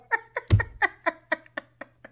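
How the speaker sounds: high-pitched burst of giggles; real laughter. Real, not acted. Recapper cracking up at a bad review, laughing.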